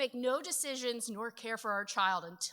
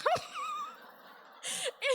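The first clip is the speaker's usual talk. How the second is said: very high screaming laughter; shrieking laugh. Real, not acted. Explodes laughing.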